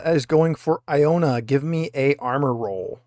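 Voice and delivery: usual speaking voice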